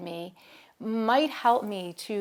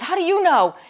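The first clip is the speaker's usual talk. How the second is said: put-on defiant child; 9 semitones higher, 12 dB louder than usual. Angry child challenges teacher.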